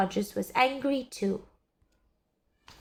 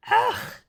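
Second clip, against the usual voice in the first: high, loud disgusted cry; disgusted scream; 14 semitones higher, 6 dB louder than usual. A narrator's reading. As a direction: recoil at the taste. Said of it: "she screamed".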